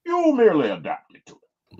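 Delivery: light menacing villain voice; mild Bane-style threat; Bane impression. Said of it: "the Bane impression".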